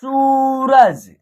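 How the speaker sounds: सूरज with सू stretched very long; long vowel. Shown as a demonstration. Teacher modelling the word for learners, सूरज drawn out. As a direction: slowly hold the ऊ in सूरज. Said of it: "आप long तरीके से उच्चारण".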